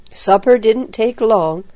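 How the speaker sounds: usual speaking voice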